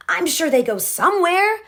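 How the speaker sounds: exasperated parent; dismissive mother. Acted. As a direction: wave the question away. Mother brushing off questions.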